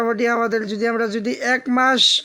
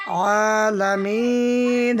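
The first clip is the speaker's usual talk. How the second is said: slow recitation; much slower than usual. Shown as a demonstration. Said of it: "এইভাবে".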